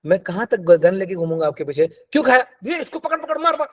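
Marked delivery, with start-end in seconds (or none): 1.9-3.7 mock yelling. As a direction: bark it like a cartoon enforcer chasing a client. Coach mock-chasing client with gun.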